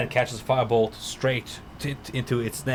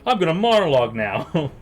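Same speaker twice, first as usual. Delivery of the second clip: grand, louder, higher monologue voice; mock villain monologue; 7 semitones higher, 9 dB louder than usual. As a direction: ham up a dramatic monologue. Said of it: "be like".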